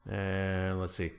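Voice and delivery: normal talking voice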